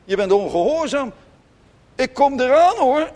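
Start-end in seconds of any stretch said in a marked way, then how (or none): 1.9-3.1 much higher and louder, rising at the end; warning call up the stairs. Retold or imitated. Parent at the foot of the stairs warning quarrelling children, loud call. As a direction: call out a threat to the kids upstairs.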